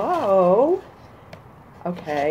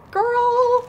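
a sudden squeal, much higher and louder; excited squeal; 5 dB louder than usual. Real, not acted. Reading her friend's card aloud, a delighted squeal. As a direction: squeal the first word of the card.

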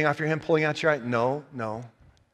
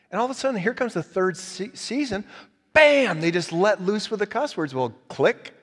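ordinary talk

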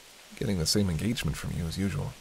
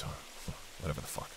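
whispered aside; 5 semitones lower, 14 dB quieter than usual. Acted. Dismissive trailing aside.

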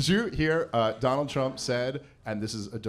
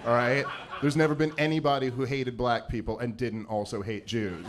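usual speaking voice

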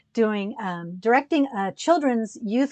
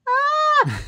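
high-pitched squeal of pure delight; excited squeal. Retold or imitated. Alone in her hotel room after meeting Louis Perez, she shrieks with joy. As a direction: let out a giddy shriek. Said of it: "I went back to my room and I was like".

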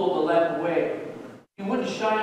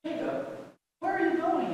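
put-on young girl voice; 11 semitones higher than usual. Sister calls out.

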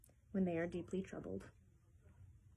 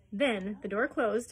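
usual speaking voice

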